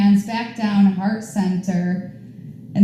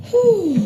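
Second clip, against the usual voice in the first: relieved whew; 12 semitones higher than usual. Real, not acted. Tired after the flow.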